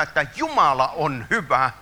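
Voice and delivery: ordinary talk, nothing marked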